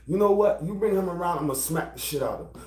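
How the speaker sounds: ordinary delivery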